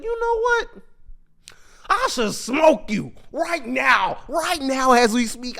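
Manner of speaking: high, loud, slow threatening shout; angry threat. Retold or imitated. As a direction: yell it like he's about to snap. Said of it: "slowly but surely getting angry".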